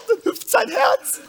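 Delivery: squeaky high jumping heart; leaping heart. Pretend. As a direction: let the voice jump way up.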